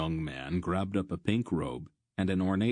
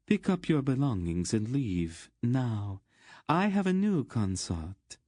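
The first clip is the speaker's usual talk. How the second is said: higher-pitched haughty command; imperious dismissal; 6 semitones higher than usual. Acted. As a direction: announce it coolly to a discarded lover. Queen casts off her lover.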